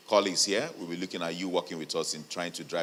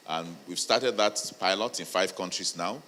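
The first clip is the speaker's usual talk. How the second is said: usual speaking voice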